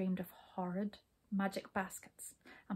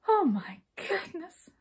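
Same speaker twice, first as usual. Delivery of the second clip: lamenting; 9 semitones higher, 3 dB louder, slower than usual. A narrator's reading. Hop starts lament.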